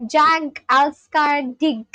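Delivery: slow careful Swedish "Jag Alskar Dig"; Swedish phrase. Shown as a demonstration. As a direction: sound out "Jag Alskar Dig" slowly, word by word. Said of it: "That is Swedish".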